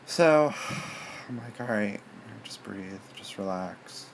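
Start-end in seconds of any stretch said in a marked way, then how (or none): 0.6-1.2 long unvoiced sigh out; deep sigh. Bracing herself before the van, a heavy sigh. 1.6-4.1 softer, talking herself down; self-calming. Retold or imitated. Nervous in the van, quietly talking herself calm.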